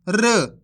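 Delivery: र as a short half consonant, "rr" with a brief schwa; teacher isolating a halved र; half-sound R. Shown as a demonstration. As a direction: sound out र as a lone half letter. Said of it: "इसी तरीके से करना है जिस तरीके से मैं कर रहा हूं".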